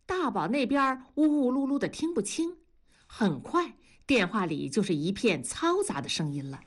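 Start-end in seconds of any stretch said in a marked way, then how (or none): none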